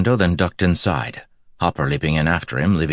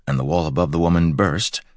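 plain reading voice

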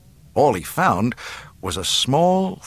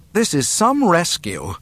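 higher-pitched, exasperated princess; peeved, pitched-up grumble; irritated complaint; 8 semitones higher, 3 dB louder than usual. A narrator's reading. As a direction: complain with scornful disbelief. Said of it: "an irritated voice complained".

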